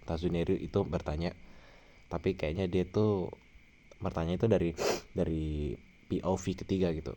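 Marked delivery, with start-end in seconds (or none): none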